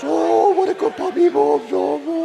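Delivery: high, loud gushing to a puppy; Tyler's baby talk. Retold or imitated. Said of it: "baby talk".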